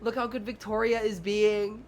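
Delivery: hammed-up teacher admiring a well-behaved pupil; praising preschool teacher. Teacher praising one child before the class, in slow showy praise. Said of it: "I'm like".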